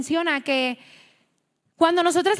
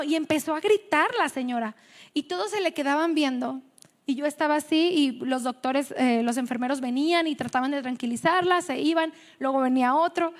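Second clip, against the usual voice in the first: normal talking voice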